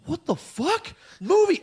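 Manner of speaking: thrilled disbelief that bursts out; excited outburst. Retold or imitated. Watching the sequel suddenly click, excitedly yelping. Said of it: "you're like".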